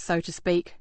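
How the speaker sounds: usual speaking voice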